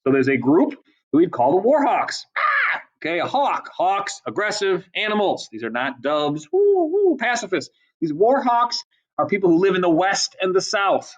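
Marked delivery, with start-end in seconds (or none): 2.4-2.8 short, high-pitched hawk cry; hawk screech. Shown as a demonstration. Imitates a war hawk's cry. 6.5-7.2 high, hooting dove coo. Shown as a demonstration. Imitates doves as pacifists.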